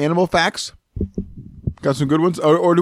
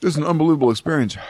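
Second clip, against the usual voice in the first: normal talking voice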